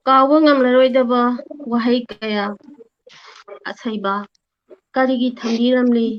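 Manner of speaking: measured verse, pausing between lines; poem delivered with swelling line openings; poem recitation. Real, not acted. Recites own poem to teacher.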